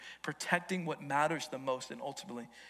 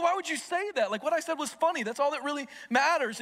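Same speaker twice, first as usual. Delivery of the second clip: higher, louder indignant snap; angry retort; 10 semitones higher, 4 dB louder than usual. Retold or imitated. Young man snaps back at his friend, indignant and angry. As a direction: defend the joke like you're offended. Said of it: "I was angry".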